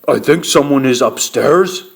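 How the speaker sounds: lightly voiced big bear; Papa Bear. A narrator's reading. Papa Bear tells family of an intruder, big-bear voice. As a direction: give a hint of a great big bear.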